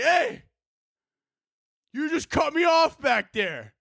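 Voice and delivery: high-pitched mock confrontation; retold angry driver. Cut-off driver confronting the comic, mock angry call. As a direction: imitate an indignant driver calling across cars. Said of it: "He got so angry".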